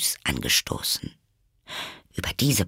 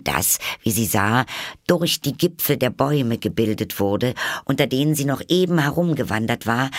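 plain reading voice

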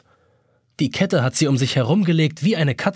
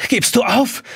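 keuchend; 8 semitones higher than usual. A narrator's reading. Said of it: "fragt er keuchend".

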